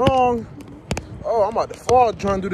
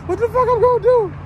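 much higher, louder panicked cry; real alarm bursting out; 4 dB louder than usual. Real, not acted. Lost rider asks viewers in panic, high panicked cry.

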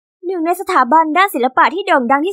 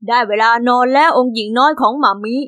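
a lower, teasing sing-song jab at her; mocking baby talk; 5 semitones lower than usual. Rival teasing the girl in front of the party, mocking voice. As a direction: belittle her like a little kid.

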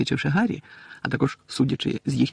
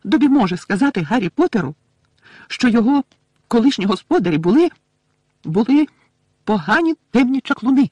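high, loud, halting elf voice; squeaky and emphatic, with halting repeats; elf plucking up courage; 10 semitones higher, 7 dB louder than usual. A narrator's reading. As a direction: brave little elf forcing the words out. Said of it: "відразу ж розправив плечі".

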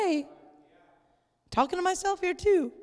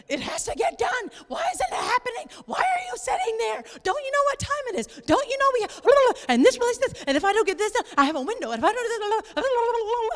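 high, fast frantic nagging; frustration mode; 8 semitones higher, faster than usual. Retold or imitated. Stressed wife pleading with her calm husband, high and frantic. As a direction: rattle it off like a panicking, stressed-out spouse. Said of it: "in frustration mode".

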